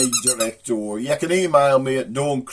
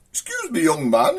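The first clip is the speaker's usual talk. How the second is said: posh woman; 3 semitones higher than usual. Acted. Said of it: "this posh woman comes up".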